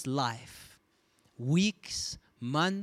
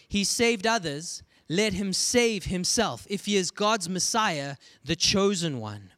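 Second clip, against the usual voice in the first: higher and louder, lightly sneering; mock sneer; 5 semitones higher, 5 dB louder than usual. Rulers jeering at Jesus on the cross, a mild sneer. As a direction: throw the taunt at him. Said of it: "the rulers sneered at him".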